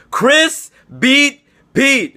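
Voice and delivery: high, very slow, drawn out; big announcement. Real, not acted. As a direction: call it like a ring announcer. Announcing the week's big upset.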